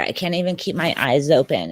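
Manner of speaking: normal talking voice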